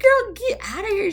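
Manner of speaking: loud, very high-pitched disbelief; high-flung, incredulous brush-off; exasperated dismissal. Acted. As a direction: shoo her off in a squeal. Exclaims at the bride's nerve.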